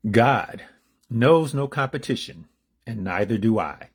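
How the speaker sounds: measured inspirational reading; read-aloud declamation. Acted. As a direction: proclaim it from the page, then settle at the end. Begins reading the meditation.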